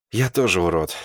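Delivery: ordinary delivery, nothing marked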